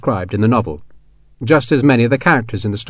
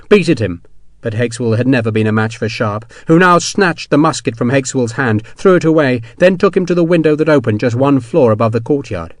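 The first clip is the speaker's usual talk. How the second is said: ordinary delivery, nothing marked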